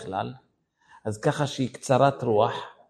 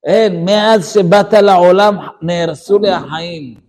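cursing the day; 4 semitones higher, 8 dB louder than usual. Retold or imitated. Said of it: "מקלל את היום".

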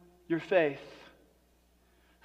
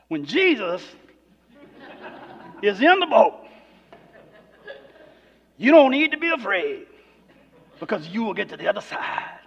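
a hammed-up preaching voice, swelling louder in the middle; mock preacher; 4 dB louder than usual. Pastor imitating a typical sermon, mock preaching. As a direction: lay on the pulpit style for the congregation. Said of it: "this is how it would go".

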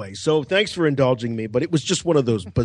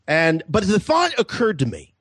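ordinary talk, nothing marked

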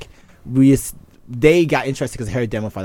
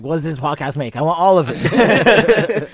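mock [name] demand; 7 semitones higher, 6 dB louder, slower than usual. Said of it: "She's like".

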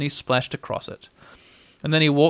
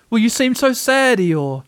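high-pitched, anxious bear; higher, louder worried voice; concerned Pooh; 9 semitones higher, 5 dB louder than usual. A narrator's reading. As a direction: ask with high, earnest concern. Pooh asks the donkey in concern.

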